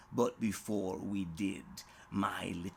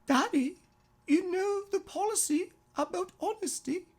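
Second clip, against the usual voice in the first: put-on high, fast little girl's voice, gently stern; a small daughter reminding her dad of the rules; scolding little girl; 5 dB louder, faster than usual. Little daughter scolding daddy in a high, fast voice.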